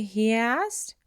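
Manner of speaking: plain reading voice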